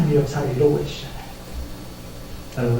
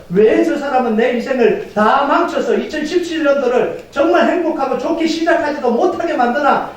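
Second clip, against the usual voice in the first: raised-pitch mock grievance; acted resentful complaint; 7 semitones higher than usual. Preacher voices believer blaming an opponent, acted complaint. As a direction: voice an aggrieved inner protest.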